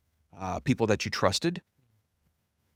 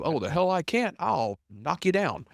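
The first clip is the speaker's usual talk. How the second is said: defiant; 7 semitones higher, slower than usual. Retold or imitated. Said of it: "I'm gonna move heaven and hell".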